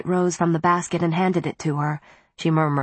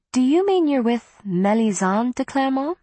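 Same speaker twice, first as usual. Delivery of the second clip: higher-pitched, intense question; 7 semitones higher than usual. A narrator's reading. Em questions Diana about Mélisande, intense. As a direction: press her intently, voice raised in pitch. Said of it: "Em's voice was intense".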